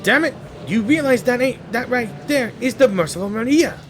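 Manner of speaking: loud and high, furious protest; Fleming yelling at Jerome over the price; angry outburst. Acted. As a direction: shout it at the greedy pawnbroker. Fleming angry at pawnbroker's lowball.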